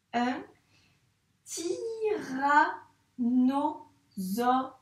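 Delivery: tyrannosaure stretched very slowly, syllable by syllable; slow syllable reading. Shown as a demonstration. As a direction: drag out tyrannosaure for a child. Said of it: "On lit ensemble". (tyrannosaure lu très lentement, syllabe par syllabe)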